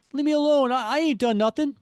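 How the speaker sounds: high, loud cartoon crook; panicked protest. Webbed-up crook protests to the hero, panicked.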